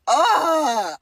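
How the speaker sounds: loud, high drawn-out "ugh"; exasperated groan. Real, not acted. Commentator fed up with a cringe rapper, loud exasperated groan.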